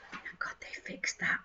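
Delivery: sarcastic whisper. Pretend. Host mocking the glue fix, sarcastic whisper. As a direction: breathe it out in dry sarcasm.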